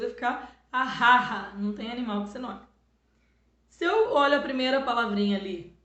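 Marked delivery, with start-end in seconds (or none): 0.0-1.6 arrarra with a strong throaty R, slow and loud; mock wrong pronunciation. Shown as a demonstration. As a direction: say arrarra with guttural R sounds, louder. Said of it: "Se eu colocar dois".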